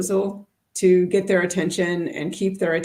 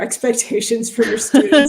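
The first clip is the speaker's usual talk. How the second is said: chuckling through the words; laughing; 4 semitones higher, 6 dB louder than usual. Real, not acted. Teacher laughing with a colleague about lowering expectations. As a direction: let a real laugh break into the line.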